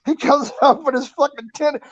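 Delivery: ordinary talk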